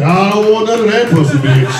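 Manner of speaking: high, loud mama line; mama yelling at the doctor mid-birth, high and loud. Retold or imitated. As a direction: belt it like mama in labor. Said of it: "she said".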